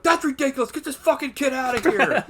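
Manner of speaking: high, loud, hammed-up dismissal; mock outrage. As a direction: imitate indignant grown-ups, nearly laughing. Mocking the film's dismissive adults.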